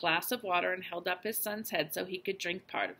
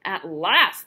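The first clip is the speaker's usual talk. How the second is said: much louder relieved outburst; 12 dB louder than usual. Acted. Mouse relieved the father is back, loud relieved exclamation. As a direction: burst out with relief.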